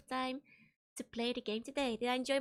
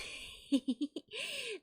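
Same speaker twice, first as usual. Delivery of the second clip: breathy laugh; 8 semitones higher than usual. Real, not acted. Laugh after mermaid joke.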